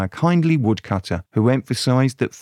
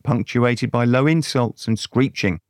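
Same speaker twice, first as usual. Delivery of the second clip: ordinary delivery, nothing marked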